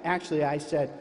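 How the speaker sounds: normal talking voice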